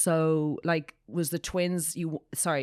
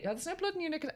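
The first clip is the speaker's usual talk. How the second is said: mock nurse question; 13 semitones higher, 5 dB quieter, much faster than usual. Mimics staff questioning.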